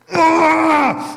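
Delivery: high loud enraged shout; furious roar. Acted. The king losing it at Haman, roaring. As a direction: let out a furious yell. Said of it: "Xerxes loses it".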